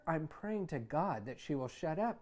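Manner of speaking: usual speaking voice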